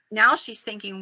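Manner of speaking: plain reading voice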